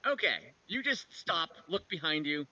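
ordinary talk

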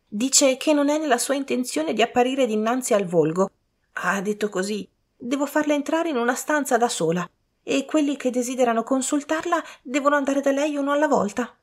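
high-pitched reporting servant. A narrator's reading. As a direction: relay her words in a high, even pitch.

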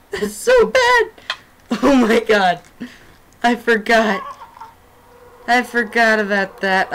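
loud high frantic screams; terrified gamer yelling under attack; panicked screaming. Real, not acted.

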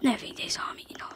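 tense hushed whisper. Real, not acted. Gamer afraid enemies spotted him, whispering. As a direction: barely breathe it.

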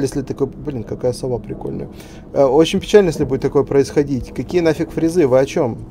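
usual speaking voice

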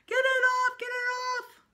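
high, loud, frantic scream; screaming child. A narrator's reading. Little boy screaming about his party hat, high and loud. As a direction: shriek it like a panicked little boy. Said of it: "Sam screamed".

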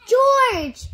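loud high call. A narrator's reading. Bill calls for the missing monkey, loud high shout. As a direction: call for him across the lake. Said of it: "he called".

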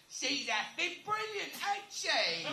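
lightly mock English accent, ham it up; bad English accent. Teasing the heckler, a bad English accent impression. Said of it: "bad imitation of an English accent".

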